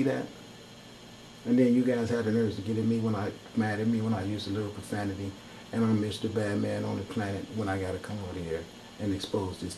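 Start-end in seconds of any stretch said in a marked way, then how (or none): none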